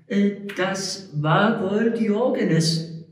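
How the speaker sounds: high, slow pedant's voice; correcting the cantor. Acted. The cantor corrects Frank, slow and pedantic. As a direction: correct him with slow, fussy precision. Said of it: "verbesserte der Kantor".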